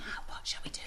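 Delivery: unvoiced teacher's whisper; teacher whispering to show how. Shown as a demonstration. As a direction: breathe the words without voice. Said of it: "I want you to whisper it".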